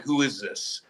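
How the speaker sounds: icy, quiet boss asking "Who is this?"; cold quiet question. Retold or imitated. General manager coldly asking who called him, quiet and stern. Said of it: "And he's like".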